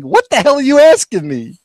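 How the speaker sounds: puzzled interviewee pushing back; confused candidate. Retold or imitated. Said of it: "looking at me like".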